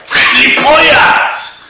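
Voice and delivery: high, loud, slow blurted outburst; sudden screeching curse; shouted swear. Pretend. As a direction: yell it out like an involuntary tic. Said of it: "started shouting things out like".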